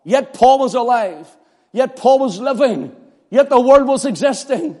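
high, loud preaching voice; fiery preaching. Acted. Preacher voicing Paul against the legalists, fervent preaching. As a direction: preach it with rising fire.

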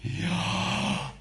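Yahweh whispered as pure breath, rising; the name made as the sound of breath. Shown as a demonstration.

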